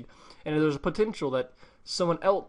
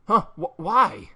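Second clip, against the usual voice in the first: high, loud, startled question; acted disbelief; shocked stammer; 6 semitones higher, 8 dB louder, slower than usual. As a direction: stammer it out in dismay. Character shocked at being told no.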